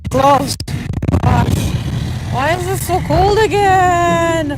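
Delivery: loud cold complaint. Real, not acted. Freezing outdoors, shouting complaints.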